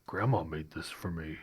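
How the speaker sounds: low, soft character voice; reluctant Gerber. Acted. Gerber asked to share his snack, low reluctant murmur. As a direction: mumble it low, guarding your snack.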